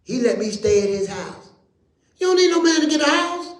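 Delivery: high, loud preacher's call; full-force preaching. Acted. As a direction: preach it up to the rafters.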